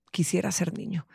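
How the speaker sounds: put-on soft, low retelling of the child's wish; retold quote. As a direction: say it quietly, like a sigh. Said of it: "y voltea y dice". (en voz baja, citando al niño)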